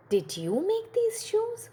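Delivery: high, wondering question; puzzled husband asking his wife; shoemaker's question. Acted. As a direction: ask in surprise about the shoes.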